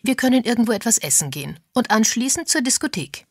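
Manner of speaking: eager insistence at a fast clip; energetic. A narrator's reading. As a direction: push the plan briskly. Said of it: "widersprach Agatha energisch".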